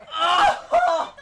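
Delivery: very high, laughing scream; real overwhelmed squeal; excited scream. Real, not acted. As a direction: shriek into a laugh. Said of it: "I'm yelling so much".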